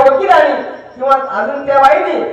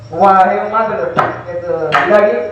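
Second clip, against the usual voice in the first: normal talking voice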